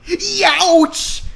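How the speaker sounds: high, sudden yelp of pain; mock cartoon ouch. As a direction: yelp like you stubbed your toes. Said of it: "he extraculated".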